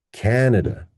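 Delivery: "Canada" with high CAN, weak endings; stressed first syllable. Shown as a demonstration. Teacher contrasting two words, "Canada" stressed on CAN. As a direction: hit CAN in "Canada", reduce the rest. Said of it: "In contrast to this one".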